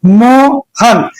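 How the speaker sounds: Mohan in two drawn-out pieces, मो, pause, हन; slow, syllable by syllable. Shown as a demonstration. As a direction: hold मो, stop, then let हन fall. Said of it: "बीच में जो है वो रुका गया". (मोहन धीरे से, मो और हन के बीच रुककर)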